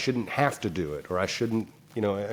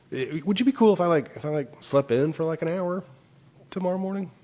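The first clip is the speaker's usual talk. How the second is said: pleading ask; 9 semitones higher than usual. Retold or imitated. Asking wife to sleep in.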